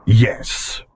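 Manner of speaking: curt flat yes; blunt. A narrator's reading. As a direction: answer bluntly. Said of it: "he answered Montferrat's question bluntly".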